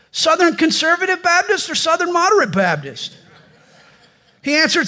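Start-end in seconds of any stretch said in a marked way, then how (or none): 0.1-3.2 high, eager voice, much higher; eager rescuer. Acted. Rescuer on the bridge eagerly quizzes the jumper, high and keen. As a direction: pitch it up, bright and keen.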